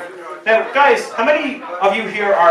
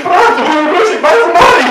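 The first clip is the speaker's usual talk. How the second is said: high, louder laughing mock Polish doctor; 11 semitones higher, 8 dB louder than usual. Doctor cracking up at the patient's bad Polish, high and laughing. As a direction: squeal it through laughter. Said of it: "It's like".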